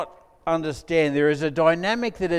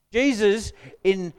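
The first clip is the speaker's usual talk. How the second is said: ordinary talk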